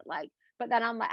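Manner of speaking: ordinary talk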